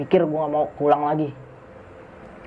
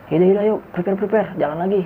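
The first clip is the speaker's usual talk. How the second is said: higher-pitched rallying call; retold pep-up, pitched up; urging; 4 semitones higher than usual. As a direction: hurry the group to get moving. Rallying friends to get moving.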